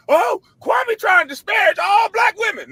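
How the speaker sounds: high, loud holler imitating accusers; mock alarm call. Imitating critics sounding a dog whistle, loud mock hollering. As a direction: shout it high, mocking the complainers. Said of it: "try to ring that dog whistle of".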